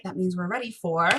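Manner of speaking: ordinary delivery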